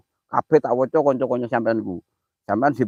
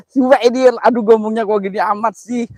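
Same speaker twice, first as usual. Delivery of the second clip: normal talking voice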